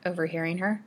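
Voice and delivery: ordinary delivery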